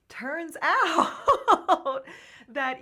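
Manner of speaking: bursting into a high laugh mid-word. Real, not acted. Guest laughing as she tells of quitting her job, laughing loud. Laughs while telling of resigning.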